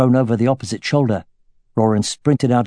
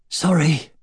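breathless; 5 semitones higher than usual. A narrator's reading. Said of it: "Khan said breathlessly".

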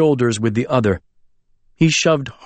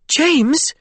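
startled squeak, much higher. Acted. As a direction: squeak the name in surprise. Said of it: "she squeaked".

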